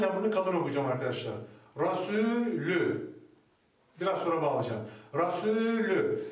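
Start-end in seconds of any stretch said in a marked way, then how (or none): 1.8-3.1 "Rasulü" drawn out slowly, light and clear; slow recitation. Shown as a demonstration. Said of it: "buradaki lamı ince okuyacağım". 5.1-6.2 "Rasulü" drawn out slowly, light and clear; slow recitation. Shown as a demonstration. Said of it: "Buraya kadarki okunuşu budur".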